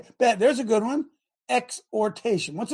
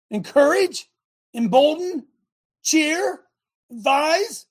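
high, punched-out list of rousing words; rally them word by word; raised, urgent cheerleading delivery; exhorting; 7 semitones higher, 5 dB louder, slower than usual. Shown as a demonstration. Acting out exhortation while reading definition.

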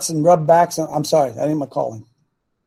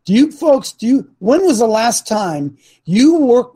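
normal talking voice

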